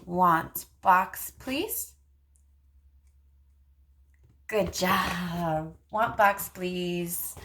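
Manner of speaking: put-on speech therapist modeling turn-taking for a child, sing-song. Shown as a demonstration. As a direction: model it slowly and playfully for a toddler. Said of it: "you could say".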